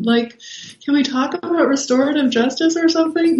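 pleading like a goody-goody hero; mock earnest plea. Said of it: "Luke Skywalker is never gonna be like".

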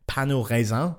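French pronunciation with a uvular r; correct French. Shown as a demonstration. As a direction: pain au raisin said the French way, nasal ending. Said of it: "in the correct French pronunciation".

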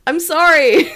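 high, loud laughing wail; squealed, tearful-with-laughter apology. Real, not acted. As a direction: apologise helplessly through laughter.

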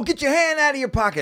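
high, sharp retold command; retold warning. Friend retells Gio warning the man, mock command. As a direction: bark the warning as Gio did. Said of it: "Gio was like".

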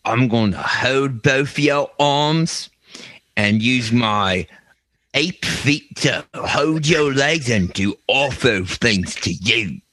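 slow, drawn-out creepy British creature; British skunk ape. Pretend. British skunk ape menacing Michael, slow creature voice. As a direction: scold slowly as a British ape. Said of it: "what would a British skunk ape sound like".

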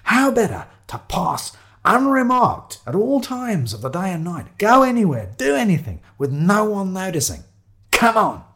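high, rousing enthusiasm; excited Shiloh. Acted. Shiloh setting off on the bike chase, exhilarated. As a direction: marvel aloud, then rally the companion.

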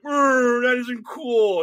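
high, loud mock whine; mocking complaint. Host mimicking people upset about birthright citizenship, mocking. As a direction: whine it in mockery of the objectors.

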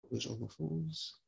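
usual speaking voice

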